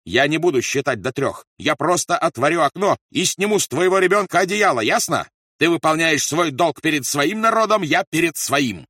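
loud, high raging tirade; furious shouting. A narrator's reading. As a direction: shout the threat, slowing on the last words. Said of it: "продолжал кричать Рольф". (яростный крик)

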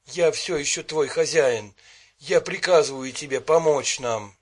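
loud, defiant command. A narrator's reading. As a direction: order the djinn, loudly.

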